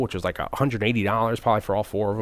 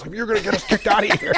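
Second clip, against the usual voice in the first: laughing scold; 10 semitones higher than usual. Retold or imitated. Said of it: "my wife's like".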